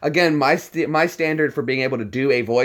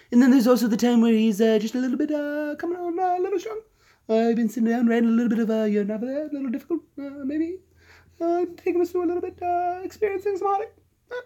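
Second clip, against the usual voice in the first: very high, softened, coming on a little strong; high, teasing cartoon baby voice; Stewie Griffin; 13 semitones higher, faster than usual. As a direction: pitch it way up and needle away, hesitantly prodding. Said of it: "Stewie has so many voices".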